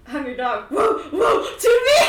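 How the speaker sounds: high, loud mock howl at the dog; imitating Hayden. Mocking how Hayden would call his dog, high and loud. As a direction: ham up the guy calling his dog. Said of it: "he'd be like".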